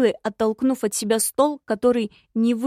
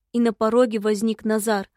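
ordinary delivery

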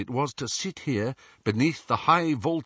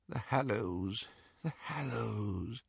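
soft, slow murmur; 10 dB quieter, slower than usual. A narrator's reading. Headmaster murmuring to Harry about his old dream, softly. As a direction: murmur it to himself, lost in regret. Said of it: "murmured Dumbledore".

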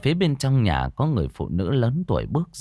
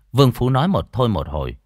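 plain reading voice

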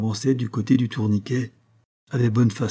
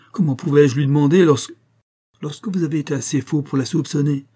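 higher, louder protest; exclaiming; 5 semitones higher, 4 dB louder than usual. A narrator's reading. John protests at being told to ask her, crying out. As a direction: cry out the question in protest. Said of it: "s'écria John".

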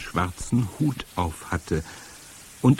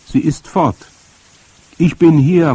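higher, loud call up the tree; raised voice, protective; reassuring call; 8 semitones higher, 10 dB louder than usual. A narrator's reading. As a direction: call up to comfort the child. Grandmother calls up to reassure.

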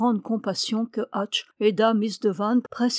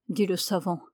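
ordinary delivery

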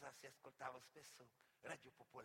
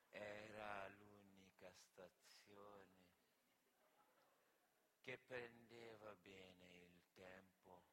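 very slow, lower, every word spelled out; slow radio preacher; 9 semitones lower, 8 dB quieter, slower than usual. Retold or imitated. Comic imitates Radio Maria's slow announcer, very slow. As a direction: mimic the radio's pious slowness.

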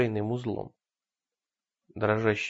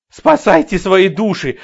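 frantic shout; 7 semitones higher, 12 dB louder than usual. Acted. Madwoman fleeing, shouting.

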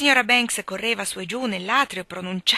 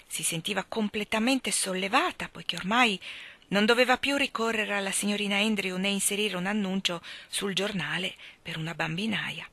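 ordinary delivery

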